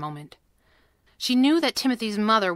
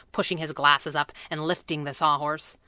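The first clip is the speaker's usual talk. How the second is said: usual speaking voice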